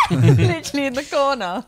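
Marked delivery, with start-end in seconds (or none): 0.1-0.1 high, loud imitated scream; shriek. Friend reacting to hearing husband, high imitated shriek. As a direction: squeal like the startled friend. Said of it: "She was like".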